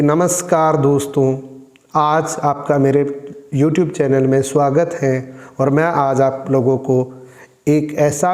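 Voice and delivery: low, slow and level greeting; low-energy channel intro; dull monotone intro. Shown as a demonstration. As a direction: welcome viewers in a listless, unvarying tone. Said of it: "शब्द वही है।".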